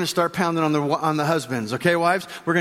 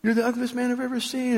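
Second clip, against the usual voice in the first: high, softened parrot voice; small high voice of a sneaky parrot; taunting parrot; 6 semitones higher, 5 dB quieter than usual. Acted. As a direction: pipe the insult softly like a parrot. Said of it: "the parrot says".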